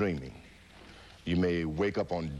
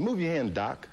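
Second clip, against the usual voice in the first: urgent cry; 7 semitones higher than usual. Retold or imitated. Fighter in delirium ordering his doctor, urgent cry. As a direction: shout it at the doctor.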